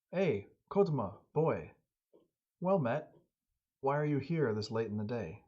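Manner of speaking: calling out loud and high to a visitor up the hill; friendly shout. Acted. Old hunter at his door hails Kotama with a loud greeting. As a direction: hail the boy from the doorway. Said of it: "he gives him a shout".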